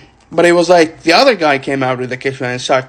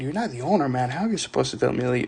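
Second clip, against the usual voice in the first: softly voiced unspoken thought; inner voice; 11 dB quieter, faster than usual. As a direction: say it under your breath, as if only thinking it. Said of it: "in my mind".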